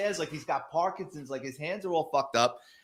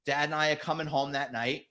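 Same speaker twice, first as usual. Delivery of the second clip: ordinary talk, nothing marked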